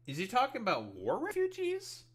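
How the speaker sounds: high, loud disbelieving question; incredulous. Real, not acted. Commentator baffled by the post he is reading, incredulous. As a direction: voice shooting up in disbelief.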